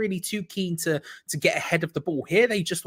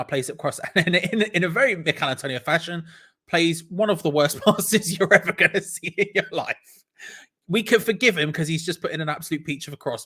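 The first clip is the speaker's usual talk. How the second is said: usual speaking voice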